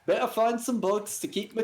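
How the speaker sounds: high, chirpy English warthog voice; Len Goodman voice. Acted. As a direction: play a cheery English warthog. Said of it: "Warthog Len, voiced by Len Goodman".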